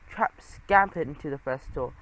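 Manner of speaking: ordinary delivery, nothing marked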